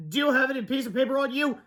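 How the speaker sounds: high, hurried-sounding character voice; urgent question. A narrator's reading. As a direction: ask it mid-crisis.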